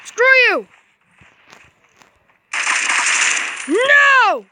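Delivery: high, anguished cries of refusal; frustrated yell. Real, not acted. Player losing a fight, frustrated high yell. Dying again in game.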